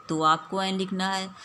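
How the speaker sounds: ordinary delivery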